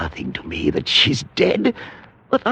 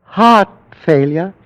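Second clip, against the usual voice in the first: ordinary delivery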